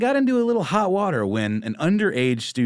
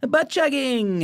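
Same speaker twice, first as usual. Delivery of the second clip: high gleeful blurt; finally allowed to yell it out; high, loud, delighted outburst; excited shout; 3 dB louder, slower than usual. Real, not acted. Finally gets to say it.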